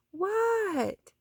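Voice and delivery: high startled reply; startled what. A narrator's reading. Froggy startled from his daydream by the teacher. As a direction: jolt awake from a daydream.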